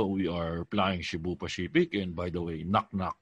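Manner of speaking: low, slow captain voice with a dad joke; tito pilot voice. Retold or imitated. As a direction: play the corny tito pilot. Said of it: "tito ba pag yung ganito".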